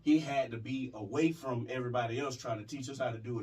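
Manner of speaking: ordinary talk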